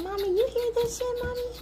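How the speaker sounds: squeaky pup complaining; dog voice way up high. Pretend. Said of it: "She's like".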